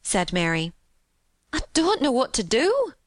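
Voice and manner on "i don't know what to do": high, fretful helplessness; worried maid, pitch climbing; agitated cry. A narrator's reading. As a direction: fret in a high Yorkshire maid voice. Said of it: "cried agitated Martha".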